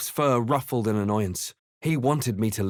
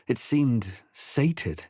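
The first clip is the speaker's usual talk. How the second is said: normal talking voice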